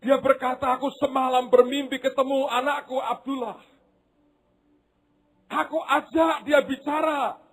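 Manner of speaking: weeping mother's outcry; fervent preaching. Acted. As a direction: cry out the mother's words.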